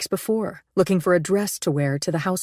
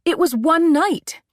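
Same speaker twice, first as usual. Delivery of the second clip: exasperated dismissal; 12 semitones higher, 4 dB louder, slower than usual. A narrator's reading. Dismissive reply.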